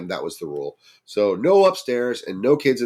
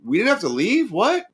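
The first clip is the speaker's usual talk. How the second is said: much higher, rising incredulous What; everyone shocked; 13 semitones higher than usual. Retold or imitated. The friends react in disbelief to the news. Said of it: "And now everyone's going".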